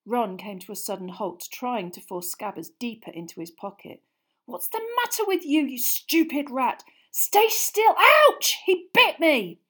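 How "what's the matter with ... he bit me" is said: much higher, louder, yelping at the rat; furious and stung, yelp at the bite; frantic anger. Acted. Ron yelling at his biting rat, frantic.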